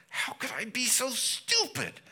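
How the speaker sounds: high, strained wail of self-hatred; anguished damned soul. Pretend. Preacher voicing a lost soul's regret: high anguished wail. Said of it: "weeping and gnashing of teeth is like".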